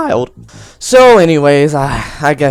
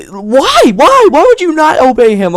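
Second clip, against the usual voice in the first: incredulous why; 9 semitones higher, 4 dB louder than usual. Acted. Mock disbelief at Tom.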